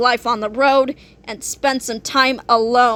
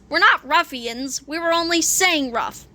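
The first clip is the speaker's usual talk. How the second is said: ordinary delivery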